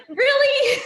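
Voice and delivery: very high, loud mock disbelief; incredulous retold question. Fiancé to fiancée who won't miss him, high incredulous question. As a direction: squeak it out in disbelief.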